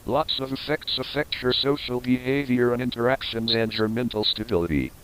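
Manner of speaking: usual speaking voice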